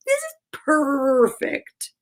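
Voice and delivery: ordinary delivery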